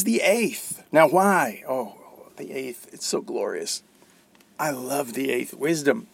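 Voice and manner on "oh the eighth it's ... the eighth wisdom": muffled, hand over mouth; speaking through a cover. Real, not acted. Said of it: "sorry, my muffled".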